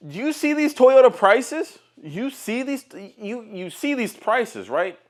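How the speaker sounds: high, stretched disbelief; incredulous. Real, not acted. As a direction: drag it out in shock. Ranting about high Toyota prices.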